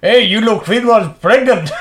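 hammed-up gleeful jeer; mock heckle. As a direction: shout it across as a joke. Mock heckle from the cleaner story.

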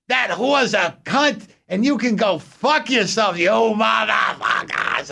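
put-on high, shrieking curses from an old woman; high, slow shrieked rant in a little old lady's voice; old lady screaming. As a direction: scream like a furious old lady alone at home. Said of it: "she screams in her apartment".

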